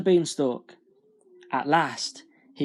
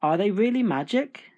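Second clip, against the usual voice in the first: put-on higher, wondering young Jack; higher-pitched, curious question; eager boy's question; 6 semitones higher than usual. A narrator's reading. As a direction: ask it with hopeful wonder. Jack asks old man, eager.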